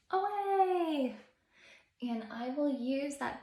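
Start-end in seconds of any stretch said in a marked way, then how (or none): 0.1-1.2 high, loud, drawn-out word; superhero takeoff cry. Shown as a demonstration. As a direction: call it out like flying off. Demonstrates superhero flying up.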